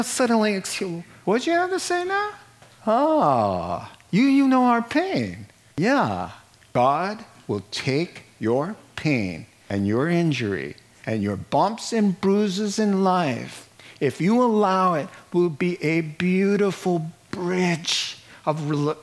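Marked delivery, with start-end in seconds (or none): none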